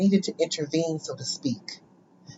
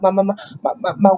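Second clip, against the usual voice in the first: stuck repeating the first word; tongue-tied stammer; 2 semitones higher, faster than usual. Acted. Student facing the teacher, tongue-tied stammer. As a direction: stammer as if nothing will come out. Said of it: "I am tongue-tied and twisted".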